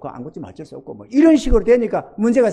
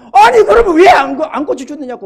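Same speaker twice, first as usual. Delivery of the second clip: higher, louder burst of complaint; upset protest; 7 semitones higher, 9 dB louder than usual. Retold or imitated. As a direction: argue back with hurt feelings. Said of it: "서운해가지고 화가 나가지고".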